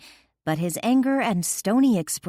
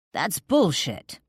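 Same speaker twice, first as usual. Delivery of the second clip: snapped retort; 2 semitones higher, 3 dB louder, slower than usual. A narrator's reading. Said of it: "he snapped".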